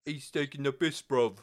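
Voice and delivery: imitating a London geezer; mock British slang accent. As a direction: play a rough British lad, punching bruv. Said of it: "you can't sound badass saying stuff like that".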